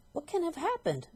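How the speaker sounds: put-on high, puzzled old woman's question; puzzled wife. Wife staring at her stick, high and puzzled. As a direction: squeak it out, baffled. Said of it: "Missus Twit said".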